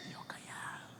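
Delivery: whisper, keeping still. Shown as a demonstration. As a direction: whisper it like hiding.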